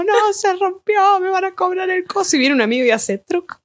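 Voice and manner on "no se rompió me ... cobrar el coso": put-on high, tearful drunken wail; hammed-up sobbing plea; mock drunk crying. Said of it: "Llorando como". (lloriqueo borracho exagerado y agudo)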